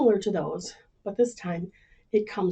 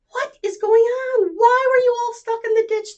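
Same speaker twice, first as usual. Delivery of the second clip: puzzled farmer; 13 semitones higher, 7 dB louder than usual. Acted. Farmer asking the stuck animals, high and astonished. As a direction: demand to know what happened.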